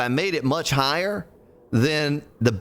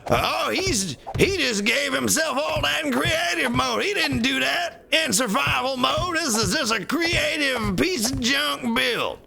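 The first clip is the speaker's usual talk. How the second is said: high, far-off sneering commenter voice; mocking commenter; 14 semitones higher than usual. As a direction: whine it like a nitpicking viewer, much higher. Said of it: "I'm gonna get that in the comment section".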